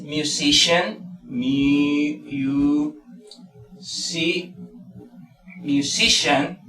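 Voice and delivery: slow "musician", broken into "mu-, si-"; syllable-by-syllable demo. Shown as a demonstration. Teacher breaks the word down for learners, slow syllables of "musician". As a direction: sound out "musician" syllable by syllable, then whole. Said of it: "mayor fuerza de voz está en si".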